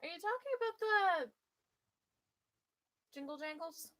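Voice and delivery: high, loud surprised question; delighted disbelief. Real, not acted. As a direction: catch what chat just said. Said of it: "reacting to chat".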